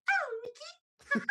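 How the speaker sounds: very high, slow, drawn-out falsetto sigh; Minnie Mouse swoon. Shown as a demonstration. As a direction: melt over the name in a squeaky falsetto. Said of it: "And then I'll s-, do Minnie".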